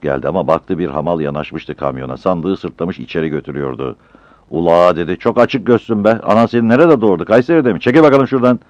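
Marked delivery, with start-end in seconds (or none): 4.5-8.6 loud, higher rough scolding; angry quarrel. Acted. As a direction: shove the rival porter off with words. Battal quarrels with the rival porter. (kavgacı, yüksek sesle azarlama)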